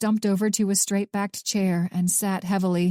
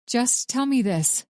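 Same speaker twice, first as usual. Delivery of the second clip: slow, controlled calm over anger; calm and reasonable; 4 semitones higher than usual. A narrator's reading. Winter holding her rage in, calmly asking the old man. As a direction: keep it level and measured. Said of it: "Her voice was calm, reasonable".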